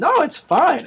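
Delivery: high and loud, it's fine; loud reassurance. Real, not acted. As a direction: brush it off loudly.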